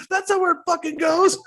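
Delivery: loud, very high exasperated screech; imitated yelling. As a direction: imitate a manic character losing patience. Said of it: "He's fucking yelling at you".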